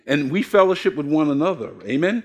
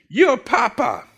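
put-on higher, giggly little girl; child voice, laughing; 6 semitones higher, slower than usual. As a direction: play the granddaughter with a laugh. Granddaughter answers, laughing.

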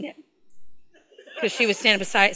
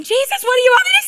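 very high, loud comic wail; exasperated high-pitched cry for help; desperate mock plea; 12 semitones higher, 4 dB louder, faster than usual. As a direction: shout it upward in pretend panic. Acting out desperate plea to Jesus.

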